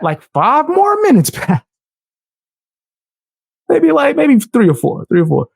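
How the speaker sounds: high pitched disbelief; high incredulous. Real, not acted.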